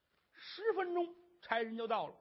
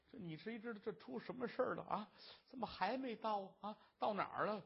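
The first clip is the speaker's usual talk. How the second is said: soft, understated tearful complaint; tearful grumbling; 7 dB quieter, faster than usual. A narrator's reading. Wife fretting in the sedan chair, softly tearful. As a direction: fret quietly to yourself, a touch weepy. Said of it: "净给我哭了".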